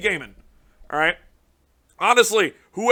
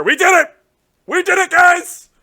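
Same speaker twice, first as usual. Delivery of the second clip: much higher, louder victory yell to the viewers; cheering to the viewers; triumphant shouting; 12 semitones higher, 12 dB louder than usual. Real, not acted. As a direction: celebrate at the top of your lungs. Celebrating the ritual card.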